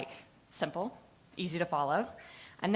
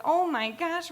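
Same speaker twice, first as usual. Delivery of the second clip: panicked gasp; 9 semitones higher, 3 dB louder than usual. Retold or imitated.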